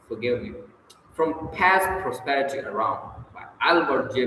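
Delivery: ordinary delivery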